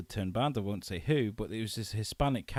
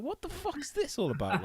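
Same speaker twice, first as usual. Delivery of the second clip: high, laughing mock outrage; laughing disbelief; 4 dB quieter than usual. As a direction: retell your baffled reaction through laughter. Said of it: "And I was just like".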